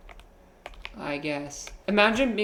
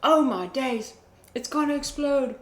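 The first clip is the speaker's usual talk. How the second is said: higher, playful British voice; mock British accent; 8 semitones higher than usual. Streamer reacting in a mock British accent. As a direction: gush in a cheeky British accent. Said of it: "alien accent popping up".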